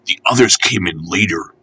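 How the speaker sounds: ordinary delivery, nothing marked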